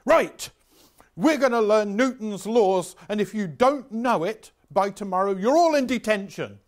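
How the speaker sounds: loud, high, barking classroom threat; shouting teacher. Retold or imitated. Welsh teacher Jones threatening pupils, loud shout. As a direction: bellow at the class like a tyrant teacher.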